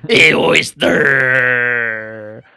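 loud, long drawn-out holler; mock name-calling. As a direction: bellow it slowly, stretching the last syllable. Playing someone mistaking the name.

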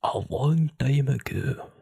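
slow Scottish bedtime narration; drawn-out mock Scottish story opening; Scottish storyteller. Imitating a sleep-story narrator, slow Scottish accent. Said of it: "he has that same accent".

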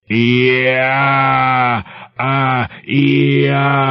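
high drawn-out shriek of terror; a long wailing shriek, held and high; inhuman shriek. Acted. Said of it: "the old man's shriek".

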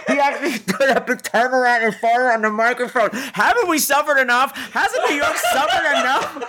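laughing outrage. Real, not acted. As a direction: talk through giggles, appalled.

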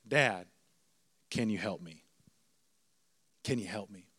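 put-on soft, low-pitched child's surrender; defeated plea. Son who broke down asks dad for help, subdued. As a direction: quietly give in, pausing between pleas.

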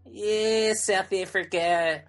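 a bad South African accent, dragged out slowly. Mocking a shrugging reaction in a bad South African accent. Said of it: "that is the worst South African accent".

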